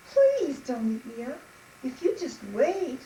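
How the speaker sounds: high, imploring little voice; small goat's earnest plea; pleading little goat. Acted. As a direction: beg the troll for mercy. Little goat begs the troll.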